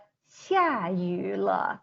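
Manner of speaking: slow, clear Mandarin read for learners; careful Chinese example. Shown as a demonstration. Teacher modelling a Mandarin sentence slowly for the class. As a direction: say "下雨了" slowly, each tone distinct. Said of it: "we have".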